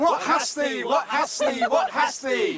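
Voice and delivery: very high, loud chanting shout; ritual shout. Pretend. Professor answers Ruth with a full-throated ritual shout. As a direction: bellow the question to the fields.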